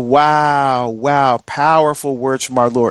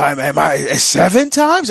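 incredulous question. Retold or imitated. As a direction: play it like seven is already a huge number. Peter asks how often to forgive.

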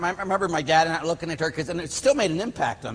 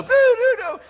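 loud, high doorbell mimicry; high, insistent doo-doo-doo chime; imitated doorbell; 7 dB louder than usual. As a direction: belt out the doorbell's ring in a high voice. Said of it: "the doorbell's ringing".

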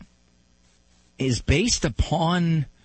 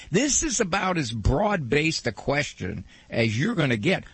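ordinary delivery

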